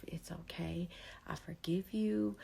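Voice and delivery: whispered, gentle self-forgiveness; soft whisper. Shown as a demonstration. Showing viewers how to speak kindly to themselves, in a whisper. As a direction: breathe it to yourself in a whisper. Telling yourself soothing words.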